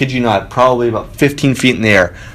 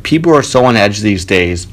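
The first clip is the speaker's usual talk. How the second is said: usual speaking voice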